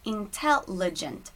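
"Intelligent" slowly divided by sound into in-tel-ligent; slow English "Intelligent", the last part "ligent" held together as one; English syllable split. Shown as a demonstration. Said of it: "essa separação pelos sons".

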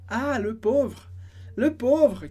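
slow, drawn-out sympathetic sigh; pitying sigh. Shown as a demonstration. Teacher modelling the friend's sympathy, slow and pitying. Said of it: "Tem várias maneiras de falar isso".

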